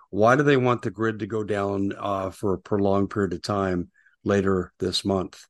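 ordinary delivery

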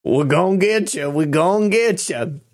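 hammed-up Georgia accent threat; mocking a bad Southern accent. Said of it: "It sounds to me like a fake Southern accent".

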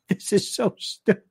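usual speaking voice

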